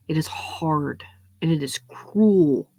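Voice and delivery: slow hushed whisper; dramatic whisper. Acted. Comic warning about the cruel world, slow whisper.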